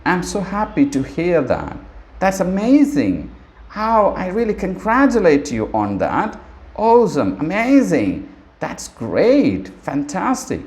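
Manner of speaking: bright high excited praise; rising delighted congratulations. Shown as a demonstration. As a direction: gush over a friend's good news. Teacher demos happy response.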